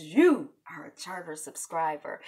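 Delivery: ordinary talk, nothing marked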